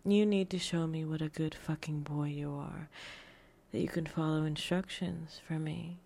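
ordinary talk, nothing marked

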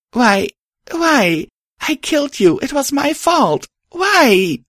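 high, anguished wail; bawling. A narrator's reading. Star grieving over the mare they killed, bawling. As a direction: wail it out, racked with guilt. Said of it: "I bawled".

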